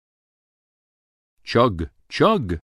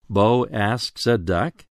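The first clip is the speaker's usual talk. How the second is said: ordinary delivery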